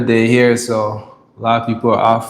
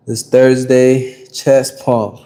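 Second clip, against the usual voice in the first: ordinary talk, nothing marked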